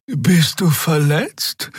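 slow, high, worried question; concerned. A narrator's reading. Xea asks the wounded Keru, slow and concerned. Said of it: "fragte Xea besorgt".

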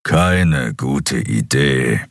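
slow, low grumble; grumbling giant. Acted. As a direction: grumble it, unconvinced. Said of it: "brummte der Skria". (langsames, tiefes Brummen)

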